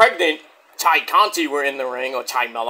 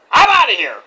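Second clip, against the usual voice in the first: storming off; 7 semitones higher, 6 dB louder than usual. Retold or imitated. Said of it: "he's like".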